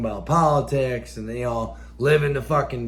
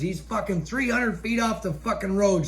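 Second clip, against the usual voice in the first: usual speaking voice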